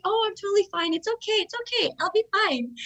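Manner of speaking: high, light, imitated brave front; mock breezy reassurance. Injured fighter reassuring people carrying her off, breezily. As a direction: brush off the worry in a high voice. Injured, brushing off concern while carried off.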